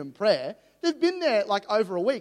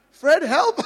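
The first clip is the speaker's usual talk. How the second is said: mock panic; 11 semitones higher, 10 dB louder than usual. Panicked prayer while praying for stranger.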